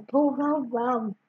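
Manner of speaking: plain reading voice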